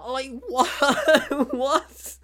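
high, loud laughing disbelief; baffled what. Real, not acted. Reviewer baffled by the film, squealing what.

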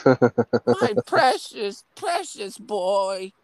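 high, crooning mother's voice; Missus Voorhees. Retold or imitated. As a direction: coo it high like a doting, creepy mother. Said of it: "That's what Missus Voorhees always used to say".

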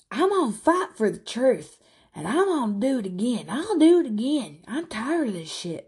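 high, fired-up imitation of Angela; mock defiant rant. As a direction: ham up her fighting talk on the phone. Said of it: "smoking and cussing".

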